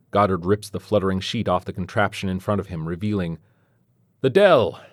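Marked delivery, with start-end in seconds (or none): 4.2-4.8 loud, high yell that falls away; excited yell. A narrator's reading. Goddard unveils his rocket to those gathered, excited yell. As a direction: announce it like a marvel to the crowd. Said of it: "Goddard yells, excited".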